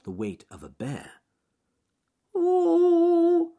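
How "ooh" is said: high, loud wavering wail; a small frightened cry from up high; little wail. Acted. Rabbit stuck on a thin branch, wailing. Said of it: "came a little wail from high above".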